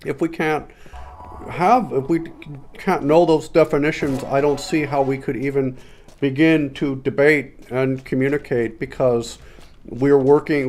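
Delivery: Peterson. Acted. Mock debater answering a right-wing bias question, Peterson impression. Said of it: "we do Peterson here".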